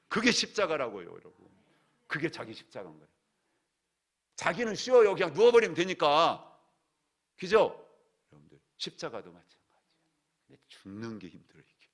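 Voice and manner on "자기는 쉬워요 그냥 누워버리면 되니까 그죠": exclaiming loudly. Real, not acted. Preacher exclaiming to congregation.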